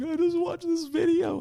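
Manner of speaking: very high, strained crying voice; slow, high-pitched retold weeping; imitated tearful wife. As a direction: imitate someone talking through tears. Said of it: "my wife is crying on the floor".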